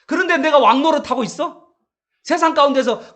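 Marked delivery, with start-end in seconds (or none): none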